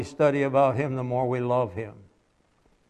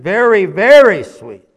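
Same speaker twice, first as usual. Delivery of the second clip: put-on much louder and higher, boy stressing very; insistent little boy; 6 semitones higher, 12 dB louder than usual. Preacher voicing the boy insisting to the man.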